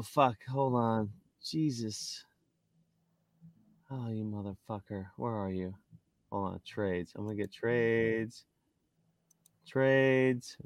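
very soft, absent-minded searching talk; muttering to himself. Real, not acted. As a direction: mumble under your breath while hunting for something. Talks to himself while searching app.

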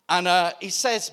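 ordinary delivery

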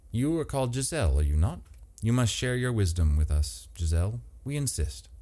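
a hint of a gentle, formal host; Andrew, calm and polite. A narrator's reading. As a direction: Andrew softly explaining, lightly voiced. Andrew presses Giselle.

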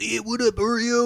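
high-pitched imitated call-out; put-on high voice, teasing; high mock greeting. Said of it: "he was like".

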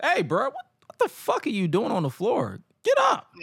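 high-pitched mock scolding; imitated yelling. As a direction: scold him up off the floor. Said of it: "He just started yelling at him".

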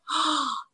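sharp gasp of realization; story-telling gasp; acted gasp. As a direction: reenact a sudden intake of breath. Acting out sudden realization in story.

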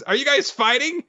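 very high put-on character voice; squeaky cartoon bewilderment; alarmed. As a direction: ask in high-pitched mock alarm. Same worried character quote.

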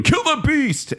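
rousing mob chant, pitched high; rally the villagers to kill; angry mob leader. Retold or imitated. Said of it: "Gaston's rousing them up and doing".

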